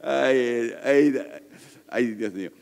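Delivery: laughing sighs. Real, not acted. Laughing after mistake.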